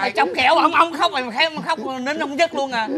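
high, loud reproach; agitated scolding. Acted. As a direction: scold the son loudly for the tears. Friend scolds son over crying.

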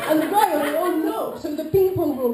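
high, loud laughing voice; laughing while speaking. Real, not acted.

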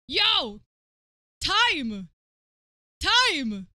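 loud call. Real, not acted. Streamer calling out the magazine cover, loud. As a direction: shout the word out. Calls out at the screen.

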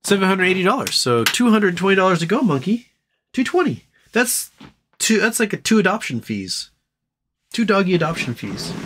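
pet talk. Real, not acted.

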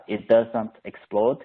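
ordinary delivery

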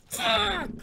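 very high, loud yelled curse; sudden shrieked curse; rage shriek. Real, not acted. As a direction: explode on one word. Sudden death in game.

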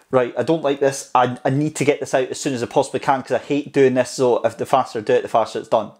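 fast, hurried inner voice; panicked rushing. Retold or imitated. Voicing a stressed mind in front of the camera, rushed. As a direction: rattle it off to get it over with. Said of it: "this fight mode where it's just like".

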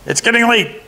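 high goblin voice; sly high goblin prodding; horned goblin nudging Herschel to light candles; third goblin voice. A narrator's reading. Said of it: "the goblin said".

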